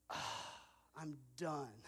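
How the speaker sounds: a soft, worn-out sigh into the words; exhausted collapse. Retold or imitated. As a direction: let it all go like falling onto the bed. Collapsing on bed at day's end.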